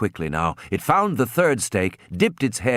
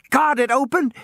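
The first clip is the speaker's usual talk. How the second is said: alarmed. A narrator's reading. Narration of sudden horror.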